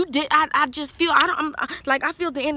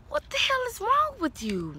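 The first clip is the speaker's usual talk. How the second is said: high but quieter, disbelieving; softer exasperation; 8 semitones higher, 4 dB quieter than usual. Real, not acted. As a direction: shake your head at Leo, softer. Reader scolds Leo again.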